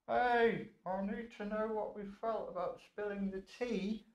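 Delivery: high, squeaky inner voice asking for data; little inner voice. Acted. Awareness calling into the data, high squeaky voice.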